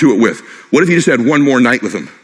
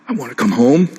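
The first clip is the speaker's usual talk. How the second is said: weak dying voice; 3 semitones higher than usual. Retold or imitated. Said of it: "My dad would talk like this".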